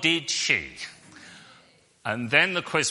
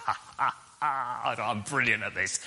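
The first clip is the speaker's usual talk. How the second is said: cocky gloating; 3 semitones lower, 4 dB quieter than usual. Retold or imitated. Acted cocky thought before music round.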